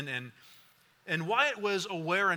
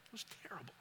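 almost a whisper, much softer, fading to nothing; the memory takes the voice away; barely audible; 3 semitones lower, 19 dB quieter, much faster than usual. Real, not acted. Repeated, nearly gone.

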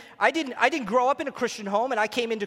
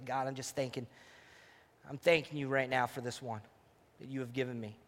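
tender prayer; 8 semitones lower, 11 dB quieter than usual. Shown as a demonstration. Demo prayer over wife.

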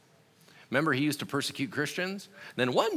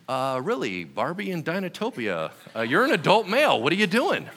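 mock judgmental clerk; 7 semitones higher, 6 dB louder than usual. Voicing the judgmental Blockbuster clerk.

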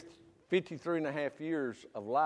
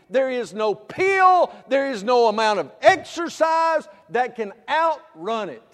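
fiery preaching; 8 semitones higher, 10 dB louder than usual. Acted. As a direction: hammer "there is no" each time. Preaching at full force.

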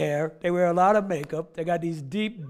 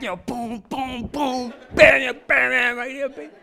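loud punchy boom bam drum hits; mock heavy rock beat; 5 semitones higher, 7 dB louder, slower than usual. Pastor mimicking a Skillet song for the congregation, loud mock drum hits.

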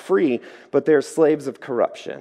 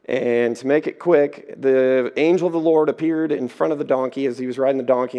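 normal talking voice

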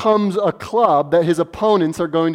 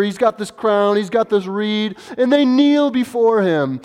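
ordinary talk, nothing marked

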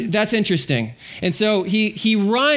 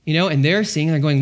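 ordinary talk, nothing marked

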